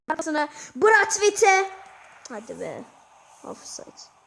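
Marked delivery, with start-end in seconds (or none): 0.1-1.7 higher, louder, slowed call of the name; going crazy. Real, not acted. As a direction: yell the name as he breaks through. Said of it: "Brathwaite diye çıldırıyorum böyle". (heyecanla isim haykırarak)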